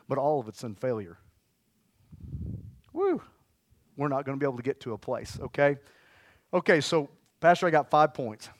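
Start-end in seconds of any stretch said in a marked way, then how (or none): 2.9-3.2 high woo; excited whoop. Real, not acted. Preacher whooping to the crowd, excited. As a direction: let out a sudden whoop.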